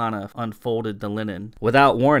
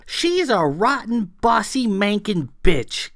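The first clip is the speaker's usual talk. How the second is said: much higher, louder roar of insults; 10 semitones higher, 5 dB louder than usual. A narrator's reading. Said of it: "Clara roared".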